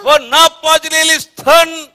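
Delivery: slow, high, very loud proclamation; drawn-out preacher's shout; thundering scripture. Acted. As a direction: belt out each word of the verse. Preacher thunders back the read verse.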